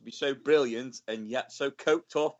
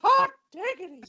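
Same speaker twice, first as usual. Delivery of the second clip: Herbert. Retold or imitated. Co-host imagining Herbert sitting there watching, high silly impression. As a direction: squeal it in a high, far-off character voice. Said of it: "imagine Herbert sitting there just going".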